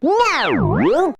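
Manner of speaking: sharp loud refusal. Acted. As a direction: shout a flat no. Blunt refusal.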